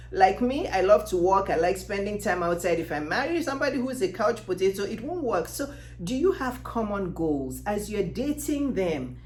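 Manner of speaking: ordinary delivery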